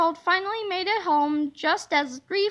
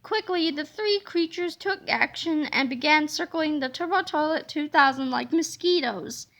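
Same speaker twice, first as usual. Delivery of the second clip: usual speaking voice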